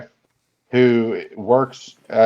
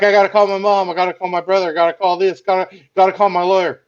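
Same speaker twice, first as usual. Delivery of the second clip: high-pitched, frantic list of calls; mock panic; 6 semitones higher, faster than usual. Retelling the boss freaking out over whom to call, mock panic. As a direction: run through it in an imitated fluster, pitched up.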